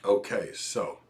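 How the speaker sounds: ordinary talk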